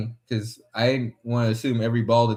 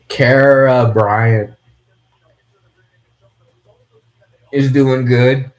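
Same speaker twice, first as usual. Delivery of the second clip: loud shout-out; 11 dB louder, much slower than usual. Real, not acted. Loud announcer-style shout-out.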